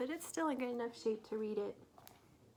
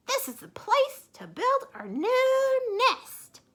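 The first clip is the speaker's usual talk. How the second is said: high, loud eager voice; Missus Bird delighted; 14 dB louder, slower than usual. Acted. Missus Bird excited about the flag house, high and loud. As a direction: gush over the flag.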